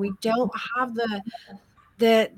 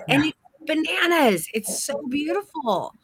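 ordinary talk

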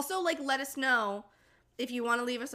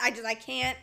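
normal talking voice

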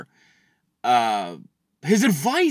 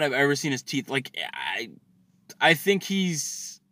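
ordinary talk, nothing marked

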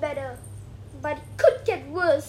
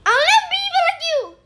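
high, loud and fast character voice; acted villain line; 11 semitones higher, 8 dB louder, much faster than usual. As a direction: fire off the vow shrilly. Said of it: "how to say it?".

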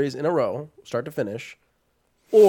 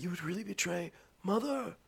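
hushed mock villain threat; lightly imitated whisper-yell; stage whisper; 4 semitones higher, 15 dB quieter than usual. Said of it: "But he's like".

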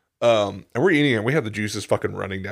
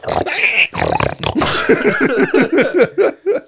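loud high cackle; 6 dB louder than usual. Real, not acted. Long high laugh.

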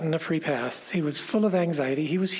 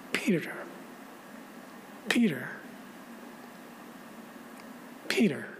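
tender whisper; 9 dB quieter than usual. Acted. Jesus voiced forgiving Peter.

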